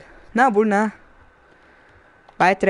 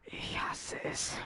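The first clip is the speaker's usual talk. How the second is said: slow frustrated whisper; 15 dB quieter, slower than usual. Real, not acted. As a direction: breathe it out in despair. Muttered in frustration.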